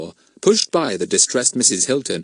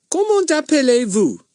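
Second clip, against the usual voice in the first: put-on boy's voice; 4 dB louder than usual. A narrator's reading. Pip asks in French.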